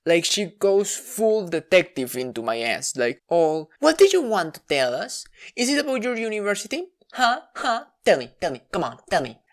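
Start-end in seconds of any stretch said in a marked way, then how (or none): 3.8-9.4 pushy, prying mom voice, higher; interrogating mom. Retold or imitated. Mom interrogating her child, pushy and prying. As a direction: grill like a detective mom. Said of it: "goes directly into interrogatory mode".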